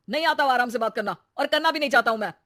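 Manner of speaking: fast, loud angry outburst; furious shout. Acted. Husband shouting at wife, refusing to talk calmly, furious. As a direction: blow up at her, refusing to calm.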